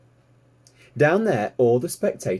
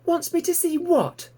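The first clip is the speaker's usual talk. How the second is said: eager piglet. Acted. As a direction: squeak it out like a curious piglet. Piglet Babe asks.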